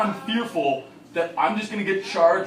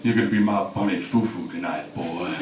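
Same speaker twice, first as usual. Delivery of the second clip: very low, monotone menace; deep, creepy convict voice; intimidating prison inmate; 11 semitones lower than usual. Acted. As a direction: play a hammed-up jail predator, pitched way down. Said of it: "is that more intimidating".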